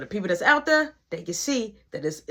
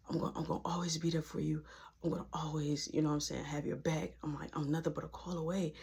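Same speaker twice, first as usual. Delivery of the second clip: quick, low reassurance; soft consoling murmur; 5 semitones lower, 14 dB quieter, faster than usual. Retold or imitated. Boyfriend reassures his crying ex, hushed and consoling. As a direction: murmur it as if into her ear. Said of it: "I'm just talking in her ear".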